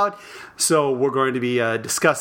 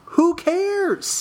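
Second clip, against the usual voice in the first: dismissive; 14 semitones higher than usual. Real, not acted. Dismissive outburst.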